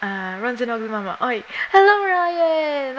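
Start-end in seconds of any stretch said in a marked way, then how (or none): 1.7-3.0 excited greeting. Real, not acted. Greeting a viewer by name.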